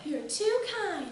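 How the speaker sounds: put-on high, soft little-girl voice. A narrator's reading. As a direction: thank him demurely, high and soft.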